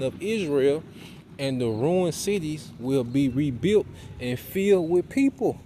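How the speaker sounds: rising preacher's proclamation of God's promise; fervent scripture reading. Acted. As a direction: preach it, building toward a ringing climax. Reads scripture rising to full force.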